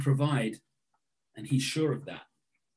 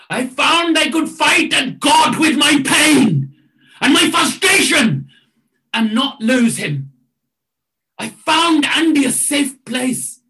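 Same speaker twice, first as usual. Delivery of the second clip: loud, high, impassioned declaration; exuberant testimony; 10 semitones higher, 8 dB louder than usual. Retold or imitated. Man from another continent tells of fighting God, exuberant shout. Voices the overjoyed man's testimony.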